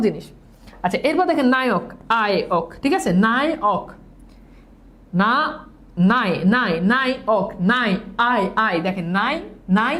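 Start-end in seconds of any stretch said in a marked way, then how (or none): 5.2-8.8 না, নাই, আয় drilled as separate, repeated sounds; syllable breakdown. Shown as a demonstration. Teacher showing students which sound occurs, না, নাই, আয় said piece by piece. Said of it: "আয় উচ্চারণ হচ্ছে".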